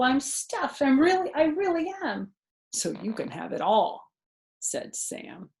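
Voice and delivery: put-on lively read-aloud for a child; animated children's story narration; storybook reading. A narrator's reading. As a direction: read it like a bedtime picture book. Reading picture book aloud.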